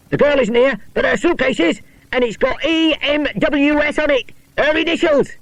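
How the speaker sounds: high, eager announcing the find; excited. Acted. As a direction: call out the discovery. George finds the suitcase.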